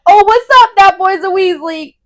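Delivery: loud, high hollered hello; hammed-up rowdy greeting; boisterous greeting. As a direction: holler a big hearty welcome. Hagrid greets Ron at the door.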